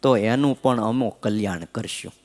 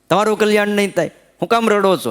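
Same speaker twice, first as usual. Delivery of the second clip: wife's teasing rebuke; 7 semitones higher, faster than usual. Retold or imitated. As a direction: rattle it off at him like a scolding wife. Wife mocks husband's crying. (ઝડપથી, ઊંચા અવાજે ધણીને ટોણો મારતી પત્ની)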